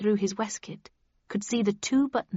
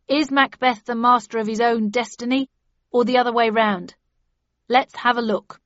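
awards announcer; 3 semitones higher, 7 dB louder than usual. Acted. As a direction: present the nominee like an MC. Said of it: "the M C announced the third nomination".

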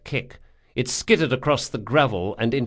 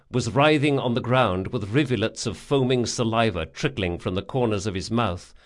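plain reading voice

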